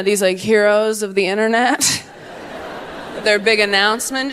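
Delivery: normal talking voice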